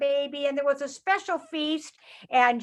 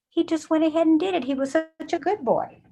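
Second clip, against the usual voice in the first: ordinary delivery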